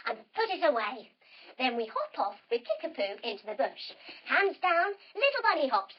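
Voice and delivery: plain reading voice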